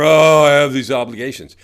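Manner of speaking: loud, weary, hammed-up complaint; mock burdened sigh.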